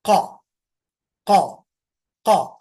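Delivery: क hit loud from the back of the tongue; demonstrating the deep क again; deep throaty q. Shown as a demonstration. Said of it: "जबान का जो आखिरी हिस्सा है".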